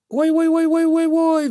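very high howling ow-ow; fox howl. Acted. Fox howls in pain after the crow pecks her, very high. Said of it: "взвыла лиска Лариска".

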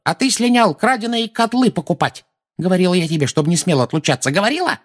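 put-on high-pitched old woman's tirade; high, indignant reproach; angry scolding. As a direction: tear into a culprit.

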